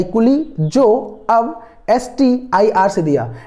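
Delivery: measured, word-by-word recital; slow dictation. Shown as a demonstration. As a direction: space out the phrase for learners. Dictating the memory trick.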